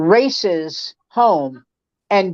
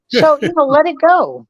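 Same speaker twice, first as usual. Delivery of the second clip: exasperated; 7 semitones higher, 4 dB louder than usual. Real, not acted. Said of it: "exasperated ending".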